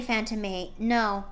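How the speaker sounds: ordinary delivery, nothing marked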